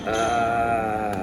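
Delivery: ordinary talk, nothing marked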